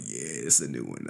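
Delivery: low, soft, offhand answer; mock nonchalant reply. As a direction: drop low and quiet, playing it cool.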